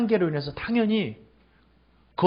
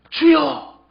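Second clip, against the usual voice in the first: high, fervent acted call; ringing imploring shout; crying out; 9 semitones higher, 3 dB louder, slower than usual. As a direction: cry out to the Lord, pitch way up. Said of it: "이렇게 부르는 거예요".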